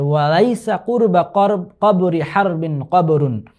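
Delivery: "Kabori Harbin কবরুন" spoken slowly, syllable by syllable; slow right down on the tongue-twister; slow recitation. Shown as a demonstration. Teacher slowly spells out the hard Arabic phrase for students. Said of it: "উচ্চারণ করতে কষ্ট হচ্ছে".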